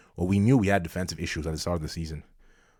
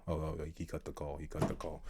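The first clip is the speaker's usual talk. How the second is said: much softer, lower, deflated mumble; dejected mutter; 4 semitones lower, 12 dB quieter than usual. Acted. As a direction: sag into a disappointed murmur. Jurgen hangs up on him.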